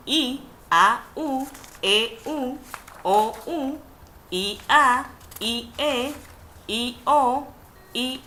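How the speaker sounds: vowel pairs I, A, U, E said slowly one by one; I, A ... I, U called out as a teacher's list; spelling out vowels. Shown as a demonstration. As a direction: recite each vowel pair clearly for the class. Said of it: "se establece la A y la I".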